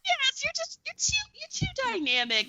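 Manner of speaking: high, slow, excited gushing; squealing high praise for the characters; delighted gush. Retold or imitated. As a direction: gush like the show won you over. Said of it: "and then I was like".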